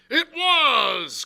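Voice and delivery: high, loud outburst; quite excited. A narrator's reading. Silver confirming the blind man's name, quite excited. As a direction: seize on it excitedly. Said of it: "now quite excited".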